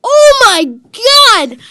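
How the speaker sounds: loud, slow, high shout; excited shout. Real, not acted. Kid shrieks at his pro pack pull. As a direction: stretch it out in disbelief.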